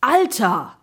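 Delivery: high, loud cry of amazement; amazed exclamation. A narrator's reading. Boys reacting to the whole story, exclaiming. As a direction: call out, stunned. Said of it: "riefen Gregor und ich".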